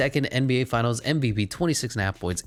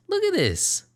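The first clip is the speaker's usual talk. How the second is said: high squeal. Real, not acted. Repeats line in squeal.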